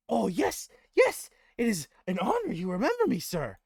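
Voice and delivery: high, loud, gushing goblin; honoured goblin. Acted. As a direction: gush with honour in a squeaky goblin voice.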